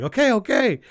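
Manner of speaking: high, loud, exasperated okay; Siegel giving in. Retold or imitated. Siegel giving up to Raven, loud and exasperated. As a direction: give in loudly, fed up.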